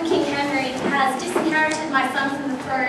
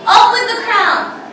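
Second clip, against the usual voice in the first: commanding shout; 7 semitones higher, 9 dB louder than usual. Acted. Queen orders York's death.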